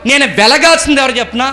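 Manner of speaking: very high, loud exhortation; full-force preaching. Real, not acted. Preacher asks whom we should shine for, full-force preaching. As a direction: ring it out over the congregation.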